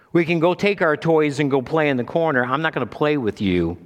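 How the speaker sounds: mock childish sulk. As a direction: act out a child refusing to play. Mocking a sulking child's refusal.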